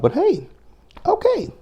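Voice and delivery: high sarcastic but hey okay. Real, not acted. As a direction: toss it off with mock acceptance. Sarcastic dismissal of drunk remark.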